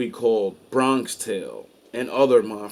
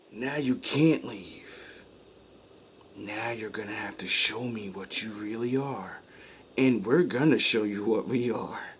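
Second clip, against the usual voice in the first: quiet menace; 3 semitones lower, 7 dB quieter than usual. Retold or imitated. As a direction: drop to a cold hush. Boss voiced, cold threat.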